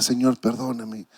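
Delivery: soft, half-crying "perdóname" repeated; a hushed, broken plea for forgiveness; retold tearful plea. Pastor retelling his roadside prayer, in a soft tearful plea. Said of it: "comenzar a llorar y decirle".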